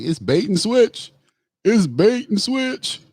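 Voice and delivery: high-pitched imitation of a shocked politician; mock shock. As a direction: mimic his shock, voice rising high at the end. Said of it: "He looked shocked".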